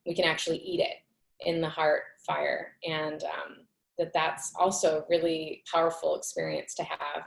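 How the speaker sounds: ordinary delivery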